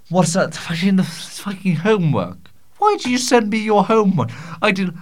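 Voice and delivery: high, loud mock indignant voice for "Why did you send me your homework?"; reading a listener's message. Host reading out a confused audience question in a hammed-up indignant voice. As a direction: act out the baffled listener, pitched well up. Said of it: "this one reads".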